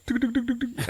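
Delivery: high, rapid tuk-tuk noise; imitated rattling. Axe wobbling on the wall, imitated rattle. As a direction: rattle off quick high clicks. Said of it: "it just goes".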